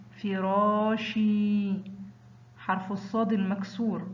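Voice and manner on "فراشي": فراشي very slowly, شي held long; drawn-out example word. Shown as a demonstration. Teacher showing pupils the long vowel, slow and stretched. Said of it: "ينطق شي".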